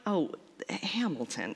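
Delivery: breathy whispered aside. Real, not acted. Preacher whispering a playful aside to the congregation. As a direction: drop to a secret whisper.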